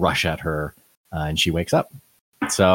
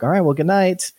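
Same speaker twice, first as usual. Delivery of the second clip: sweet goodnight; 9 semitones higher than usual. Retold or imitated. Players saying sweet goodnight.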